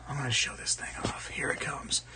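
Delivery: soft, hushed whisper; teasing whisper. Pretend. Streamer about to show off new knife, teasing whisper. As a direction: whisper it like a secret reveal.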